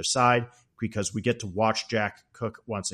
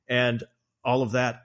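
ordinary talk

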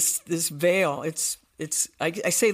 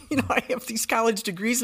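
laughing; 5 semitones higher than usual. Real, not acted. Laughs at own story.

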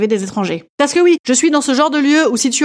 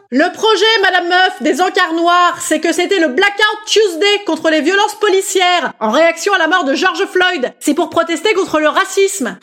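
high, insistent declaiming; drawn-out, high mock-activist speech; lecturing heckler; 6 semitones higher, slower than usual. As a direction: lecture her in a pitched-up militant voice. Heckler figure lectures Madame Meuf.

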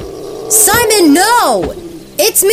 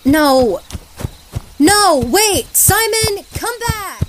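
desperate calling; 13 semitones higher, slower than usual. Acted. Calling after him.

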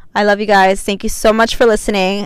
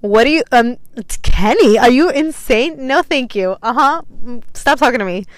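offended diva; 3 semitones higher than usual. Retold or imitated. Retelling her brush-off of men who offer Henny, hammed-up disgust. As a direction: play the appalled princess turning him down.

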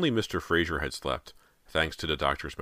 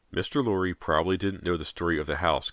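ordinary delivery

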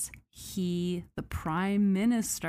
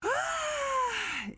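exasperated cry. Real, not acted. Exasperated outburst.

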